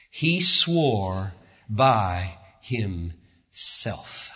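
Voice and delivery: slow, measured reading of the verse; drawn-out reverent reading; scripture reading. Acted. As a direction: read it out with weight, slowing at the end. Reads verse thirteen aloud.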